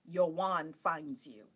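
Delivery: slow, deliberate, word by word; solemn pronouncement. Real, not acted. Telling viewers how a wand comes, slowly and solemnly. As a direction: space the words out like a revealed truth. Solemn maxim after discovery.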